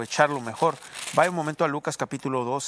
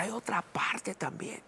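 whispered emphasis; 6 semitones higher, 6 dB quieter, slower than usual. Real, not acted.

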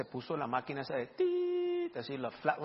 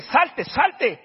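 loud, higher, blunt repeated order; rude shout; 9 semitones higher, 11 dB louder than usual. Retold or imitated. Preacher ordering the daughter out, rude shout. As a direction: bark her out of the room. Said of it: "sonó grosero".

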